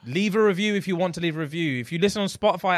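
usual speaking voice

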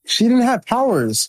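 high-pitched insistent protest; heated protest. Real, not acted. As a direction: insist she had no powers, voice up high. Heated argument over Taskmaster powers.

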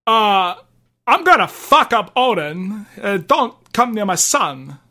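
halting celebrity take on Kratos; lightly voiced actor impression, playing a protective father; a hint of a famous actor's cadence; Christopher Walken. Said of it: "Christopher Walken doing his version of, uh, Kratos".

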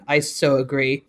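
low, softly acted reply; deadpan agreement. Reader voicing the other friend in the exchange, low deadpan. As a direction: drop low for the second character.

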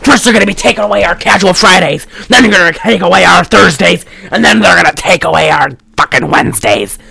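wrestling-promo style tirade; ranting conspiracy theorist. Retold or imitated. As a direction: build a paranoid rant like a wrestling promo. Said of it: "he cuts another wrestling promo".

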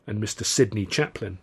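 usual speaking voice

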